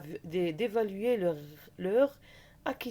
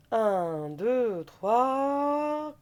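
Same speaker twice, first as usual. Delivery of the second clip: counting down; 4 semitones higher, 5 dB louder, much slower than usual. Shown as a demonstration. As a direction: count out, louder, slowing on the last word. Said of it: "counting down". (compte à rebours appuyé)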